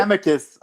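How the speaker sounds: Amicus as AM-uh-kus; first-syllable stress. Shown as a demonstration. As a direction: hit the short a opening Amicus. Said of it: "I say Amicus".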